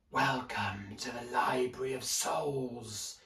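slow, hushed and otherworldly; eerie librarian voice. A narrator's reading. Hooded librarian greeting the children, slow eerie hush. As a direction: welcome them like a ghostly guide. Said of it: "in a whispering voice that seemed to come from everywhere".

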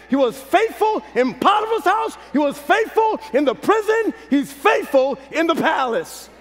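rising, climbing preacher's cadence; building sermon. Acted. Preacher building to the congregation, rising fervor.

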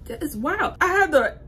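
high and loud, exasperated; loud, high disbelief at the workout; worked up. Real, not acted. Reliving breathless workout.

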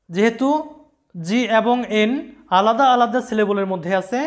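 normal talking voice

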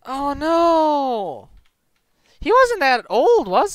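high, drawn-out cry of dismay; sudden alarm, stretching the words; dismayed surprise. Real, not acted. Reacting to in-game death.

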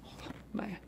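whispered, breathy oh man; hushed overwhelmed sigh of words; breathed oh man. Retold or imitated. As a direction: let it out as a stunned breath. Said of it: "He went".

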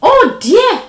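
high, loud dismay; startled storybook dog; alarmed exclamation. Acted. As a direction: react with loud concern to a yelp.

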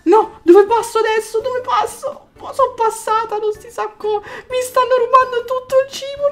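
high, tense panicky talk; panicked. Real, not acted. Gamer trying to escape, high panicky talk.

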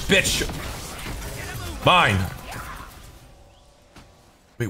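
ordinary talk, nothing marked

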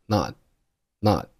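"not" with the t swallowed; unreleased t. Shown as a demonstration. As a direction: shape the t of "not" but give it no sound. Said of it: "但是把声音给吞掉".

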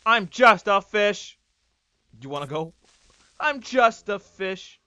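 hammed-up pleading cry. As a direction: beg loudly, half joking. Pleading with attacker.

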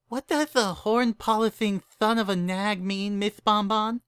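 put-on high, slow lisping child voice; lispy filly. A narrator's reading. Young assistant asks about overheard swearing, lispy filly voice. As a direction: innocently ask in a high filly lisp.

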